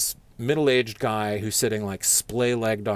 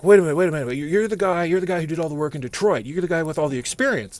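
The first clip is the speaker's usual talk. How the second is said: higher, louder dawning excitement; excited recognition; 7 semitones higher, 6 dB louder, faster than usual. Retold or imitated. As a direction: suddenly realize who he is. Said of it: "he's like".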